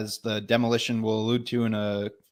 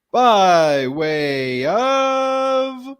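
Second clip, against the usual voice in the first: announcer build-up; 9 semitones higher, 7 dB louder, much slower than usual. Pretend. Mock sponsor lead-in to clip.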